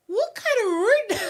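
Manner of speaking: high, loud, disbelieving cry; outraged viewer shrieks at rude Simba; shocked outrage. Real, not acted. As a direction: squeal it out in shock.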